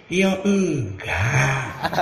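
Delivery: drawn-out, low, unbothered "ya tidak"; slow low calm refusal. Retold or imitated. Kiai Ma'ruf turning down the petitioner again, slow and low. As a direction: say no slowly, unmoved.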